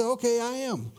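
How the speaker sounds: high, offhand read of "Okay, I am"; blown past casually. Shown as a demonstration. Preacher showing how readers skim the words, offhand. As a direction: toss off "I am" as if it meant nothing. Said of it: "so easy ... to blow past".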